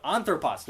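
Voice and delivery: ANthropos, weight on the "an"; stress on first syllable. Shown as a demonstration. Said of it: "that acute is on that first syllable".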